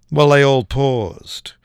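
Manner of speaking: ordinary talk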